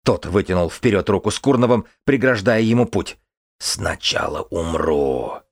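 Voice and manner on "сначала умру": медленно, с низким шипением; прошипи упрямо, как загнанный зверь; голос дикого существа. A narrator's reading. Дикий отвечает, голос твари. (низкое шипение твари)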